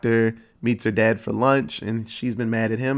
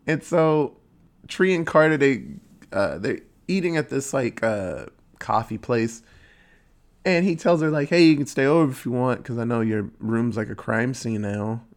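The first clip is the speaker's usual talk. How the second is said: ordinary delivery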